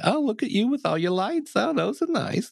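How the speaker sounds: high, gushing mom voice; sky-high proud mom impression; doting mom. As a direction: coo at him like a proud mother. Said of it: "you're like".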